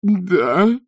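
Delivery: high, loud peep; strangled little squeal; unnaturally thin squeak. A narrator's reading. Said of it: "пропищал он неестественно тонким голоском".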